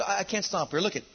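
ordinary talk, nothing marked

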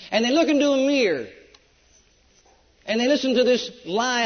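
loud, driving sermon delivery; full-force preaching. Acted. Preacher warning the church about devils, loud and driving. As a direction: preach it hard to the congregation.

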